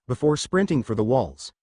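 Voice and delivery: ordinary delivery, nothing marked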